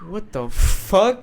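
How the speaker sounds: high, slow disbelieving outburst; can't believe what you just heard; incredulous. Real, not acted. Shocked by milk and pizza.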